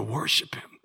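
hushed reverent whisper. Acted. Preacher telling of the magi worshipping, hushed whisper. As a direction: breathe it out in a whisper.

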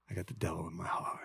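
fast, breathy whisper of a son confessing; whispered quote of a grown son. Retold or imitated. Pastor voices a grown son whispering he's turned from faith. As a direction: hush it like a secret confession.

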